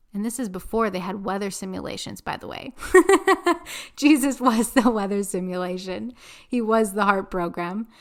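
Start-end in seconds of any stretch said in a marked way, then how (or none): 2.2-2.7 breathy under-the-breath aside; whispered aside. Real, not acted. Host tossing off a joke in a whisper. 2.8-3.9 loud high laugh; real burst of laughter. Real, not acted. Host cracking up at her weather joke. 3.9-6.1 laughing. Real, not acted. Said through laughter.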